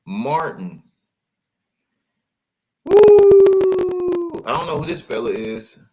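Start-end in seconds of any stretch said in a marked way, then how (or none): 2.8-4.4 high loud whooping holler; loud whoo. Real, not acted. Whooping at Martin's photo. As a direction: holler in disbelief.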